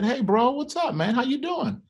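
put-on high, softened voice for a child; gentle dad check-in. Dad checking on his scared young son in the car, gentle.

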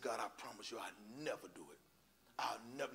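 very soft, low promise; retold prayer, voice dropped right down; hushed vow. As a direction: murmur a humbled promise to God. Retold quiet promise to God.

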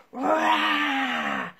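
playful roar; mock roar. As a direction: roar like a bear for the kids. Kids asked to roar.

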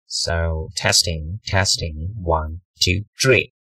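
low, slow mic test. Shown as a demonstration. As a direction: drop the voice well down and draw it out. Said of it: "This is the lower voice".

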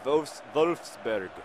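Wolfsburg slow with a German V; second slow German try; careful mock German; German pronunciation. Shown as a demonstration. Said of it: "I'm trying to practice my German".

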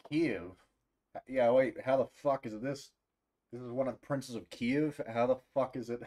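loud, high incredulous questions; can't believe what you're reading; stunned, swearing in confusion; baffled disbelief. Real, not acted. Streamer baffled by crown's origin.

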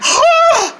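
high sharp gasp; surprised gasp. Acted.

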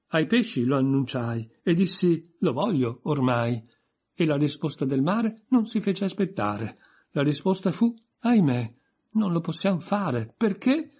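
pompous sing-song recital; lightly voiced pompous poet; poem recital. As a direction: declaim it like a proud reciter. Humpty recites his poem.

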